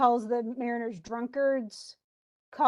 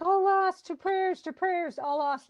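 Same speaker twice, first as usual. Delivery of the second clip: high, panicked wail of doomed sailors; 7 semitones higher, slower than usual. Retold or imitated. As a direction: cry it out like drowning men, pitched high. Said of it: "And they're like".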